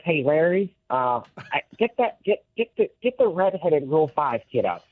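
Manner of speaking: high, fumbling manager voice; Lou Piniella. Acted. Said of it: "he says".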